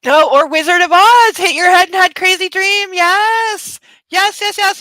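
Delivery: excited. Real, not acted. Host excitedly pitching a Wizard of Oz ending to Dale. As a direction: burst out with the idea.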